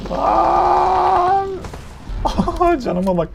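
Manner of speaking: very high shriek into a laugh; shriek, then laugh in disbelief; shocked shriek. Real, not acted. Near death, shrieks and laughs.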